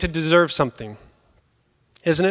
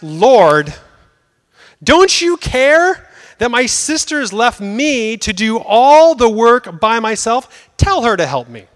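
a hint of an aggrieved sister, louder and higher; complaining Martha; 4 semitones higher, 5 dB louder, slower than usual. Martha complains to Jesus about her sister, exasperated. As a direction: mildly voice Martha's protest, pleading.

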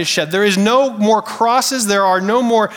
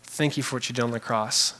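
much softer, lower voice of prayer; hushed and lowered prayer voice; quiet prayer; 7 semitones lower, 8 dB quieter than usual. Retold or imitated. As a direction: speak as a humble prayer. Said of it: "We say".

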